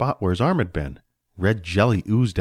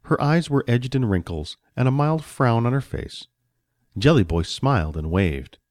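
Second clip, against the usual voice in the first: usual speaking voice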